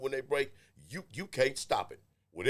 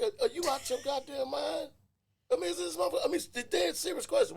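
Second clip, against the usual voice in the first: high-pitched comic disbelief; high, squeaky-comic indignation without extra volume; mock outrage; 12 semitones higher than usual. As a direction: act out indignant incredulity, voice way up. Acts out his outraged reply.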